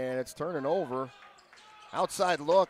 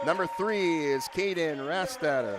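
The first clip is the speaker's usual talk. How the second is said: big booming lineup introductions; echoing stadium announcer; 7 semitones higher than usual. Acted. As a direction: announce each name to the arena. Reads home lineup over PA.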